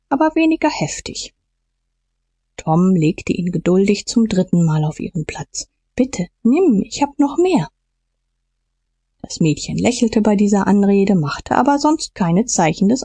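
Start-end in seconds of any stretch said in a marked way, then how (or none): 6.0-7.7 coaxing offer. A narrator's reading. Tom offers girl a peach.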